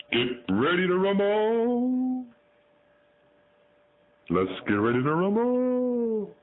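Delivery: high, stretched boxing-ring announcer cry; announcer call. Pretend. Owner teasing his parrot with a high ring-announcer call.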